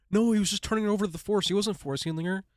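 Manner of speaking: high, fast contradicting commenter; mock objection. Host quoting viewers who argued with the video, mock objection.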